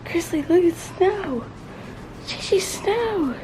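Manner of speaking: high, excited call to the dogs. Real, not acted. Showing the dogs the first snow, high excited calls. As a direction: call the dogs over in delight.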